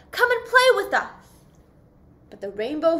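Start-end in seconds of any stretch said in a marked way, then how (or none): 0.1-1.1 high, loud call to a friend. Acted. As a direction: shout across the water to come play. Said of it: "they would call".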